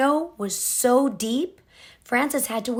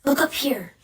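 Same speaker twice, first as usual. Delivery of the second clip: usual speaking voice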